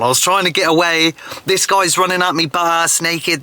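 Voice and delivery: ordinary talk, nothing marked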